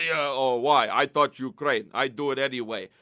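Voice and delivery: sulky defiant villain voice, low; mock Putin. Putin voiced in retold exchange.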